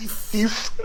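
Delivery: "thief" leaned on, high and breathy with a laugh; stress on thief. Retold or imitated. Fan laughing while stressing "thief" in a Boromir quote. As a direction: hit "thief" hard. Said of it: "You always emphasize".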